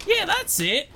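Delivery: high-pitched, gleeful outburst with laughter; tickled and thrilled; delighted. Acted. Delighted teasing of friend's pose.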